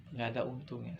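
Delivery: soft, sinking repeat of "Nggak ada untungnya"; quiet echo. Real, not acted. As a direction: let the repeat fall away to a hush. Repeated warning, echoed quietly.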